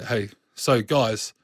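slow call for the group's attention; unhurried, measured opener to pull listeners in; slow, deliberate. Shown as a demonstration. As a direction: draw each word out with purpose. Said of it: "then you just start slowly talking".

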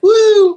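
very high, loud whoop; imitated woo. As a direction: imitate a rocker's cheering woo. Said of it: "kept looking at me like".

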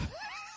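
high wheezing laugh that fades out; wheezy laugh. Real, not acted. Podcaster cracking up at his own shark comparison, wheezy laugh. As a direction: laugh in high, quiet, fading pulses.

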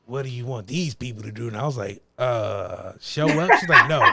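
a hint of a stern questioner; mock stern boss. Employee retells boss's pointed questions, low and mock stern.

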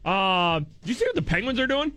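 ordinary talk, nothing marked

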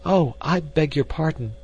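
high-voiced, startled apology; exclaimed apology. A narrator's reading. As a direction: exclaim it high, as if walking in on others. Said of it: "she exclaimed".

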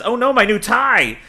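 high, mock-upset goon crying over his tie; lightly hammed-up distress over a tie. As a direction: wail about the ruined tie like a fussy henchman. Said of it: "yells the".

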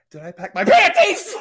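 hammed-up loud grandma, high-pitched; mock yell building to the last word. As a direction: belt out a comic grandma holler. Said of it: "you said she said it loud".

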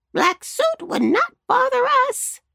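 high squawky crow. Acted. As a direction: talk like a big crow. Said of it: "said the largest crow".

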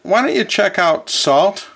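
normal talking voice

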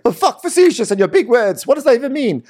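very high, loud mock rant; mock dumb outrage. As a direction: squeal like an offended meathead. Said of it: "And so James is like".

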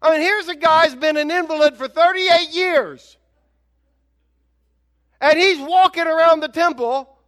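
loud, high preaching in full indignant flow; impassioned preaching. Real, not acted. Preacher to congregation about the leaders' wrong question, impassioned preaching.

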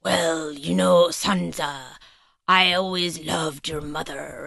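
slow creepy schemer impression, drawn out; Littlefinger, creepy. Littlefinger moving in on Sansa before the kiss, creepy voice. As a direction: sidle closer with a slow, creepy voice. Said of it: "he starts in with the creepy voice".

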